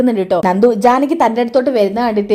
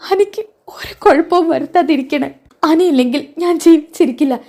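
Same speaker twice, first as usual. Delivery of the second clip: tearful pleading prayer, voice raised; crying prayer; 5 semitones higher than usual. A narrator's reading. Nandu crying, praying for Ani at the hospital. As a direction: cry out to God for her husband. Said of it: "ഇതൊക്കെ പറഞ്ഞ് നന്ദു കരഞ്ഞ്".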